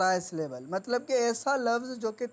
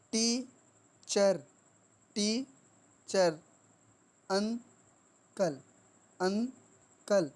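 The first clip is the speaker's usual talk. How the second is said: Teacher and uncle very slowly, each broken into two beats; two-syllable split; much slower than usual. Shown as a demonstration. Teacher showing two sounds in teacher and uncle, syllables pulled apart. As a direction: pause mid-word: टी-चर, अं-कल. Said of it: "दो sound निकल रहे हैं".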